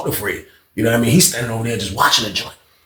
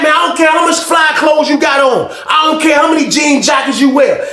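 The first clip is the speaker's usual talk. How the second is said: higher, louder hammed-up accusation; mock yelling accusation; 13 semitones higher, 4 dB louder, slower than usual. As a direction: shout to the whole tier that he's a cop. Old man accuses loudly.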